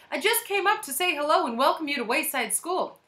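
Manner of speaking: high, friendly lady's voice; cheery visitor. A narrator's reading. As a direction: call a welcome through the door. Visitor greets new teacher through door.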